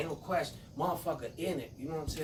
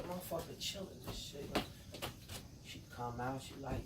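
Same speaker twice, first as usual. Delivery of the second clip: ordinary talk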